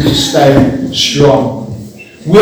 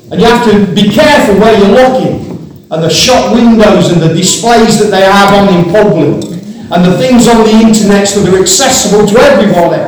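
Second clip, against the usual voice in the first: raised high, ringing preacher's voice; preaching at full force; 5 semitones higher than usual. Acted. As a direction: lift it and drive the message home.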